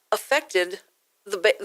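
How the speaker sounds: usual speaking voice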